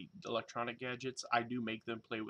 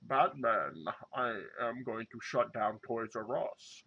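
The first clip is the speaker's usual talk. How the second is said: slow, put-on villain voice; bad Bane impression; slower than usual. Villain taunting Batman about Toys R Us, mock menacing. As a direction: ham up a supervillain threat. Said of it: "that's my worst Bane impersonation".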